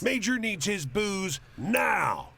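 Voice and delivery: high, loud, impatient command; barked order. A narrator's reading. Army driver ordering a passer-by to run booze to base, barking. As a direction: shout orders like a furious driver. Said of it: "orders the driver".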